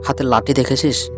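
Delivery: usual speaking voice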